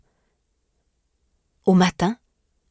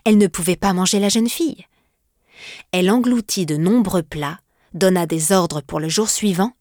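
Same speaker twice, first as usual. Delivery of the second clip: ordinary delivery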